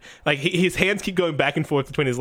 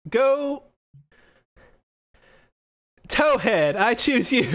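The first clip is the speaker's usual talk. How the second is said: put-on high-pitched, hesitant young trainer; a bit nervous; 9 semitones higher, slower than usual. Young trainer sending out Towhead, nervous. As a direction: call the Pokemon out high, with nervous pauses. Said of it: "he seems a bit nervous to even say this name".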